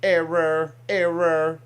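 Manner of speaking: robot voice repeating error; glitching robot. Pretend. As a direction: glitch out like a broken android. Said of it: "my little impressions there".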